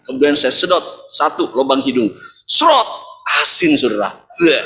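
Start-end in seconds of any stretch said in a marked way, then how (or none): 2.5-2.9 high, loud sucking slurp; slurp noise. Retold or imitated. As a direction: mimic a quick suck through the nose. Imitates sucking the baby's nose.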